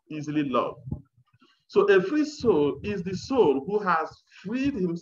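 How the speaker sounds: ordinary delivery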